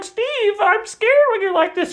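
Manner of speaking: very high, thin falsetto fear; hammed-up wife frightened of her husband; mock scared wife. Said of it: "And she's like".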